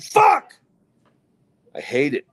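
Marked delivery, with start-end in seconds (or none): 0.1-0.5 sudden high, loud curse; exasperated yell. Retold or imitated. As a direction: re-enact a yell as the heat hits. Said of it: "and I go".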